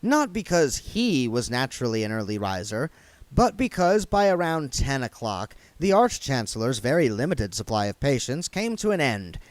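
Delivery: usual speaking voice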